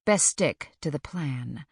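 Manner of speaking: plain reading voice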